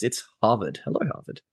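ordinary delivery

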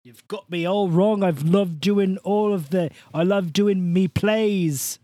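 loud, high, hammed-up Irish brogue; Irish accent. Irish actor defending his theatre work, Irish accent. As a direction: protest in a broad Irish accent, stretching the last words.

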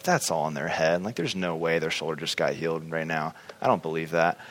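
quiet scornful disbelief; sceptical mutter. Retold or imitated. His retold sceptical inner voice.

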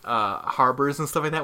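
ordinary delivery

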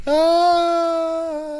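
high scream fading down a pit; loud scream of falling. Shown as a demonstration. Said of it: "hole in the ground scream".

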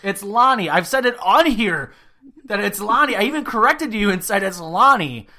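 loud, high, fed-up correction; exasperated yelling. Real, not acted. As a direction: shout it like you've said it a hundred times. Correcting the name repeatedly, frustrated.

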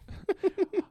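squeaky laugh burst; high-pitched giggle. Real, not acted. Laugh after joke.

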